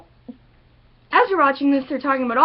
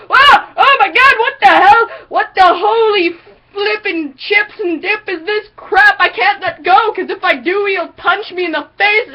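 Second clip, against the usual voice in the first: panicked shark; 7 semitones higher, 7 dB louder than usual. Retold or imitated. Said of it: "the shark is just like".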